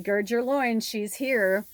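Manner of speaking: a hint of the assistant bracing the office; movie line quote. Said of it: "and he's like".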